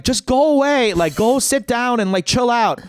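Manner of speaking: high-pitched exasperated brush-off; imitating annoyed friends. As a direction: shoo away a pest, mock-irritated. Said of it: "annoyed at me".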